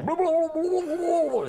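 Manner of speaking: high bubbling blah blah; gurgling under water. Retold or imitated. Friend's head pushed in a bucket during prank call, high gurgling blahs. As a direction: fake a man gargling underwater. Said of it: "all you hear my friends go".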